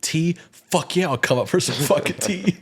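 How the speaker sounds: high, hyped-up mock inner voice; gung-ho inner narration. His inner narration answering the tea invite, hammed-up excitement. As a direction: accept with exaggerated eagerness. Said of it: "And then goes to the narration".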